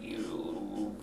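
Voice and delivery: unvoiced whispered you. Imitating Benicio del Toro's dead character, whispered. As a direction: rasp it like a dying man. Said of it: "He's like".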